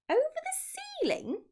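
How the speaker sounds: high, rising disbelief; can't believe the order; astonished cry. A narrator's reading. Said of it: "they cried".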